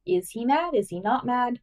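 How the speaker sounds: usual speaking voice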